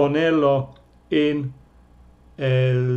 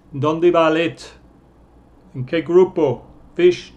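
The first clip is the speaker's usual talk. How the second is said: normal talking voice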